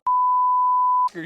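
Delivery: short high-pitched laugh; real laugh at the memory. Real, not acted. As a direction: burst out laughing. Laughs recalling the image.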